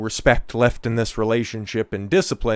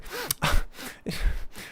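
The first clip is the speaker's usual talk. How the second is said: high airless scoff of a laugh; breathy mocking laugh; 7 dB quieter than usual. Real, not acted. Streamer scoffing at the wife's crying, airless laugh. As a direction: laugh it off dismissively.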